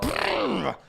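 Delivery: spooked horse lip-trill; imitated horse snort. Cyclist imitates the freaked-out horse, snorting. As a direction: blow a startled horse noise through the lips.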